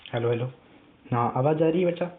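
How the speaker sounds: ordinary talk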